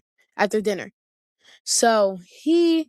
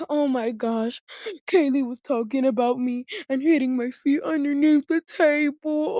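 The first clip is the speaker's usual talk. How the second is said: lightly voiced whiny brother telling on her; a hint of fake whimpering complaint; mock sob story; 4 semitones higher than usual. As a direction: whine like a tattling little brother. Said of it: "going through this whole entire sob story".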